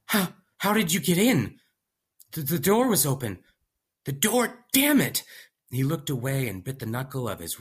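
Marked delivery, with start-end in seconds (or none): 0.1-1.6 high startled nervous man; panicked young scientist voice. Acted. Startled scientist confronting intruder. 2.3-3.4 nervous stammer. Acted. Narrator stammers excuse. 4.0-5.2 high, loud panicked curse; freaking out over the door; frantic young scientist. Acted. Scientist curses his mistake.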